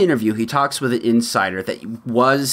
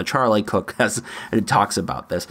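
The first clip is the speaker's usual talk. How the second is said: usual speaking voice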